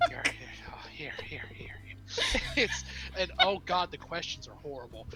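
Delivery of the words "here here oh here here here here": giggly whispered here, here. Real, not acted. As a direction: hush it out, half laughing. Handing something over, giggling.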